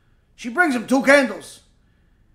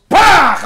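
explosive, high imitated whack; loud smack noise; 9 semitones higher, 11 dB louder than usual. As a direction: blurt it out like a sudden blow. Imitates candles smashed on a head.

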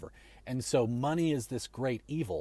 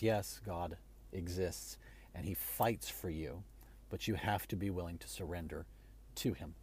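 sincere and soft; 5 semitones lower, 8 dB quieter than usual. Real, not acted. Tender faith appeal.

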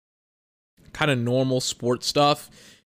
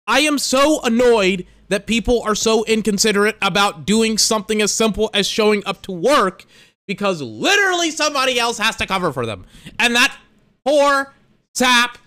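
heated rant; 6 semitones higher, 4 dB louder than usual. Real, not acted. Ranting about covering shifts.

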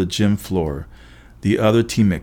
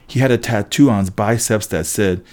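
plain reading voice

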